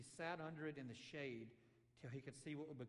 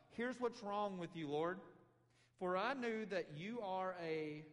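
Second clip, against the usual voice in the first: slow, louder accusing tone; mock complaint; 4 semitones higher, 6 dB louder, slower than usual. Jonah griping at God, slow accusing complaint. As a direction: drag out the accusation like a grievance. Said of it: "the horrible things he has to complain about God".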